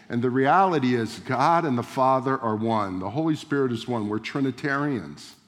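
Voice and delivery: ordinary talk, nothing marked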